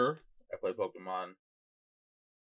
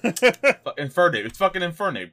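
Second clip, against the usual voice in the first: louder, higher; excited exclamation; 7 semitones higher, 9 dB louder than usual. Real, not acted. Gamer correcting himself to his friend, triumphant and loud. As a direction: blurt the name out triumphantly. Remembering starter evolution.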